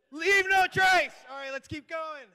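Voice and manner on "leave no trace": slow, loud, high rally cry; slogan shout. Real, not acted. Manager rallying the crowd, slow loud shout. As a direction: draw out the slogan big.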